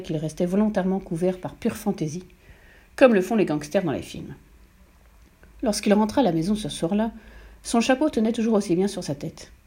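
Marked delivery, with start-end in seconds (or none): none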